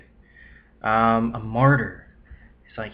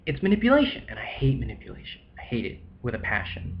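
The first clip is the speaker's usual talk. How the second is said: hushed, breathy whisper of disgust; real whisper; 2 semitones lower, 7 dB quieter than usual. Real, not acted. Speaker whispering his hatred of manipulation to viewers, hushed. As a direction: drop to a whisper and lean in.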